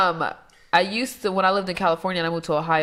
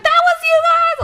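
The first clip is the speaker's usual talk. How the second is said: high, loud astonished question; imitated shock; 8 dB louder than usual. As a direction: act out her stunned outburst. Retelling the woman's shocked reply.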